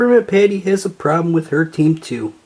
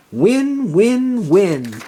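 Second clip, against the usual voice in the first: dreamy excitement; 6 semitones higher, much slower than usual. Acted. Dreamy repeated word win.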